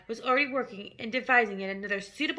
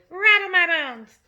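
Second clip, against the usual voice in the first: slow, loud, higher ghostly parrot; spooky voice; 7 semitones higher, 9 dB louder, slower than usual. A narrator's reading. The parrot repeats its spooky line, slow and loud. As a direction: drag it out like a ghost. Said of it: "imitation of a spooky voice".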